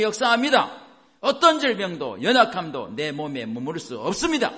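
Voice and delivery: high, loud, emphatic declaration; roaring proclamation. Shown as a demonstration. Preacher leading next confession for congregation, loud proclamation. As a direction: declare it forcefully for all to repeat. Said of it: "선포하시라고요".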